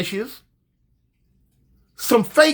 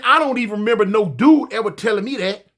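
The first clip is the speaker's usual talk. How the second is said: ordinary talk, nothing marked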